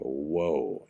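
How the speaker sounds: low, soft, falling whoa; retold stunned reaction; hushed shock. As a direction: take in the horror quietly. Awed reaction to the nightmare.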